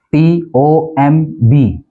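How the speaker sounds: ordinary talk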